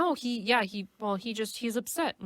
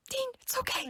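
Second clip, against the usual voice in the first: tiny high, soft cooing voice; mock tender comforting; 5 dB quieter than usual. [name] soothing a crying Dean, mock tender comforting. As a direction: squeak it gently, as if soothing through tears.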